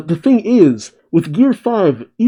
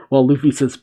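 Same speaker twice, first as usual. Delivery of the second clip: ordinary delivery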